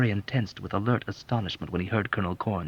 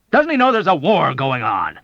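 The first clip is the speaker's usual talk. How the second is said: loud, higher outburst of disbelief; indignant colonel yelling; sudden yell; 8 semitones higher, 8 dB louder than usual. Acted. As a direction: bellow it in outrage. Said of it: "yelled out suddenly".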